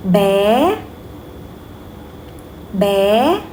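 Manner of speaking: "Bé" swept upward at the end; rising tone. Shown as a demonstration. Teacher models a practice word, rising tone. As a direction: lift each "Bé" like a question. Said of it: "raise your voice a little bit".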